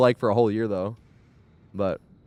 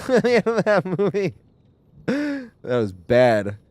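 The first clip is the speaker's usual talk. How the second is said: laughing; 9 semitones higher, slower than usual. Real, not acted. Laughing recalling crying at film.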